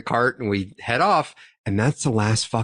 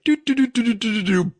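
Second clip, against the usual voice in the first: high "doo-doo-doo" tipping sound; 12 semitones higher, 3 dB louder, faster than usual. Retold or imitated. Telling friends how the cart tipped, high mimicked sound effect. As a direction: sound out the cart tilting over in high little notes.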